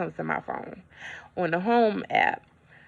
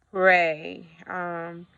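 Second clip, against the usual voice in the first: ordinary talk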